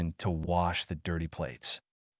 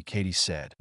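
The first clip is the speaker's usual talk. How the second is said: usual speaking voice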